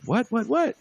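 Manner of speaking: high rising repeated what; baffled what. Retold or imitated. Dude baffled in front of security, high confused whats. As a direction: act lost and baffled, pitch way up. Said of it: "he's sitting there like".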